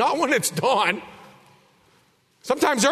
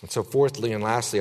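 ordinary talk

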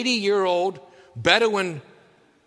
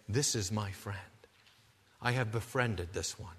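tender divine voice; 10 semitones lower, 11 dB quieter than usual. Retold or imitated. God's voice, soft and low.

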